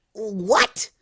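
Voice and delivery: voiced Rainbow Dash shrieking. A narrator's reading. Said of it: "Rainbow shrieked".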